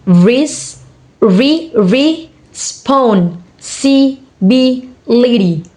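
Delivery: responsibility very slowly, in separate beats; syllable by syllable. Shown as a demonstration. Teacher showing six syllable word, syllable by syllable. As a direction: pause between the syllables of responsibility. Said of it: "six syllable word".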